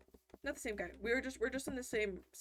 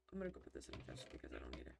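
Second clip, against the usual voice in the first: whispering; 10 dB quieter, faster than usual. Real, not acted. Muttered aside while playing.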